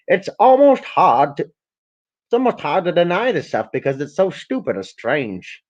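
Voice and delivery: mock Gates quote; quoted Gates. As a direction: read Gates' words in a slow mock voice.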